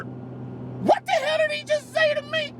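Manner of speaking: can't believe what he just heard; stunned disbelief. Retold or imitated. Thinking to himself after the car owner's insult, stunned disbelief.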